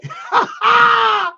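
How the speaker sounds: high burst of laughter, almost a shout. Real, not acted. Laughs loudly at the chat.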